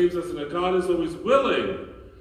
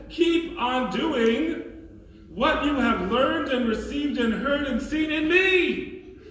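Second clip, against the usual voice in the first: quoting Saint Paul; 6 semitones higher than usual. Retold or imitated. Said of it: "He says".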